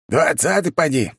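high rotten-mouthed bellow; yelling. A narrator's reading. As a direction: bawl it out into the air. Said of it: "проорал он своим гнилым ртом".

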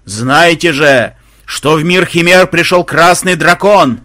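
slow, loud, drawn-out proclamation; wailing voice from above. A narrator's reading. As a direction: howl it like a herald from the heavens. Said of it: "С небес раздался завывающий голос". (протяжный завывающий глас с небес)

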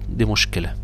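normal talking voice

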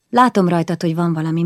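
ordinary delivery

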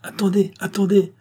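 cri pressant. A narrator's reading. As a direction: call out to halt the objection. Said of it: "cria Rouget de Lille". (cri aigu et pressant)

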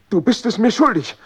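drängender Ausbruch. Acted. Drohung an den Freund.

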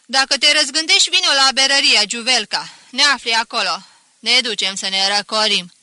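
loud friendly call from a distance; shouting. Acted. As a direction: call back loudly from the gate. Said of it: "se întoarse și îmi strigă".

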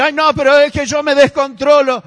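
mock a believer's lame excuse, whining; whiny excuse. Preacher mocking a believer who blames lack of control, whiny. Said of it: "Entonces uno dice".

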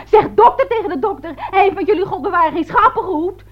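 high-pitched angry scolding; retold indignant shout. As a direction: re-enact telling off a bully. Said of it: "Ik schreeuwde tegen hem".

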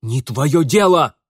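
loud, drawn-out angry yell. A narrator's reading. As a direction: shout her down. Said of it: "заорал Пётр".

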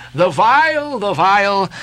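restrained shriek; mild piercing shriek. A narrator's reading. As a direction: cry it out high, without full force. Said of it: "Raising a piercing shriek".

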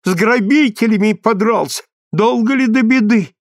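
high-pitched alarmed fretting; flustered old mother, voice up high; aghast exclamation. A narrator's reading. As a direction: cry out in worry for your boy. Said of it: "ахнула Марья Семёновна".

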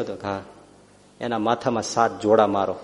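usual speaking voice

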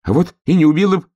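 high, fast, loud contradiction; defiant retort. A narrator's reading. Hedgehog argues with bear cub, defiant retort. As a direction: snap back high and quick.

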